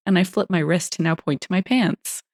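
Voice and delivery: ordinary delivery, nothing marked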